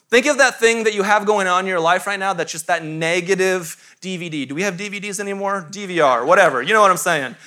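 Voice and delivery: normal talking voice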